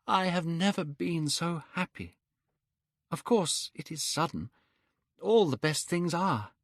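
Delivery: a lovestruck youth pouring out his joy; young man, elated. Acted. Dorian and Hallward voiced in story.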